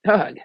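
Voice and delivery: loud, shuddering groan of disgust; hammed-up grimace of a sound; disgusted ugh. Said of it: "she gives a dramatic shudder".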